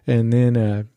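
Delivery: ordinary talk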